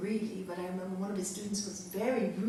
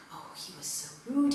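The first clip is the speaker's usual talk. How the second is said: whispered gossip. Retold or imitated. People whispering complaint after satsang.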